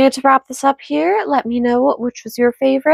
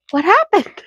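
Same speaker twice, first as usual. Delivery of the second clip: mock surprise; 8 semitones higher than usual. Teasing next story.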